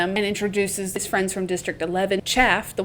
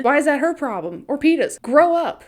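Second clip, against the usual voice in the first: higher-pitched, rising indignation; scornful retort; 6 semitones higher than usual. Acted. Retorting to jealous victors, with rising scorn.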